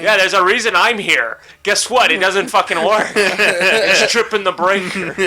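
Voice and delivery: half-laughing fed-up venting; angry. Retold or imitated. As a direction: retell the comeback with mock indignation. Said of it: "I was just so angry".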